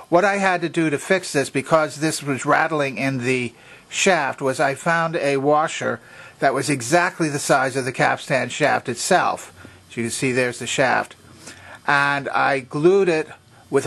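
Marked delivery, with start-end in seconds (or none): none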